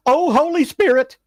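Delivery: high, loud panicked shriek; screaming girls. Retold or imitated. Girls fleeing a snake, shrieking high and loud. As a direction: shriek it, full fright. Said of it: "go running across the street going".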